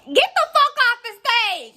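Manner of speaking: 3 bark it at the screen; 1 yelling at him. Real, not acted. 4 vlogger ordering the lying guest offstage, loud and high.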